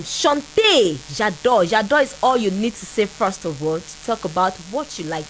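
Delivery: normal talking voice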